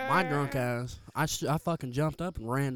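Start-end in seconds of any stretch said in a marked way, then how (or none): none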